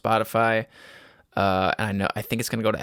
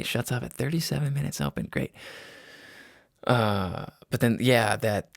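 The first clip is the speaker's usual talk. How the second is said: usual speaking voice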